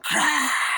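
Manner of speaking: very high, loud imitated screech; creature screech. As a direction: screech like the thing. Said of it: "the fucking thing starts going".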